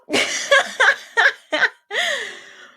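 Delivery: loud, high burst of laughter; laughing. Real, not acted. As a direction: crack up at your own joke. Laughs at own TED talk joke.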